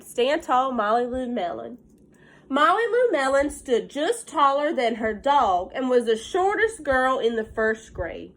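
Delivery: lively picture-book read-aloud; reading to children. Acted. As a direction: read it out to the class with gentle excitement.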